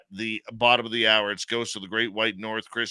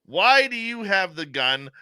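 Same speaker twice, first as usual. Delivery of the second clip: retold scream; 8 semitones higher than usual. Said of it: "The team is screaming at him".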